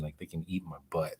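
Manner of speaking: fast, whispered aside; cheeky whisper. Real, not acted. Streamer whispers a rude jab at the copyright system. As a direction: hiss it fast like a naughty secret.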